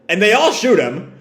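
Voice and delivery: normal talking voice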